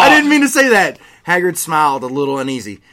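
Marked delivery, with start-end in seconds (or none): 0.0-1.0 high, loud flustered yelp; a mortified Snape yelling his denial; panicked shout. Acted. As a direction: blurt it out in horror. Snape panics after blurting.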